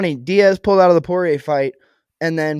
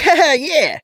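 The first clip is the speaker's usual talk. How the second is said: mock clueless laugh; 11 semitones higher, 3 dB louder, slower than usual. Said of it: "they're just like".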